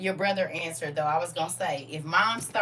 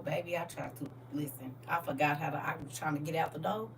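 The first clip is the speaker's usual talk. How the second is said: normal talking voice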